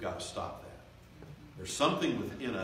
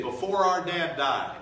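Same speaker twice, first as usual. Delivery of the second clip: pleading brothers; 8 semitones higher, 11 dB louder, slower than usual. Retold or imitated.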